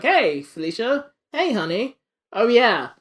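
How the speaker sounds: high, drawling mock sweet-talk; slow, lilting hello to the wife; playfully voiced Hobgoblin; smug villain husband greeting. Voicing Hobgoblin greeting his wife.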